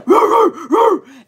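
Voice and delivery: loud, high mock barks; imitated dog barking. Imitating Mai Tai outside the bathroom, loud barking. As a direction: bark like an alarmed dog. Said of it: "Mai Tai's a".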